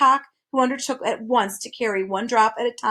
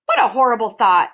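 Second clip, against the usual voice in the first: shuddering; 6 dB louder than usual. A narrator's reading. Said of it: "the bird shuddered".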